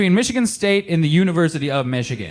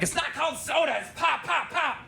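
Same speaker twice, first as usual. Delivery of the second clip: high-pitched outburst, hammering each repeat; mock furious yell; 9 semitones higher, 9 dB quieter than usual. Armed Michigander correcting someone over soda, mock furious yell. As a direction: blow up over a trivial word. Acted Michigander snapping at polite question.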